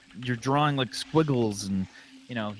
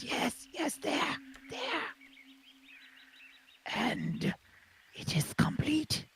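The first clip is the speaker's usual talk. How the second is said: high whispery creature voice; whispery dragon voice; 13 semitones higher, 9 dB quieter, slower than usual. Acted. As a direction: breathe it out like a tiny drake. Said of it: "and he says".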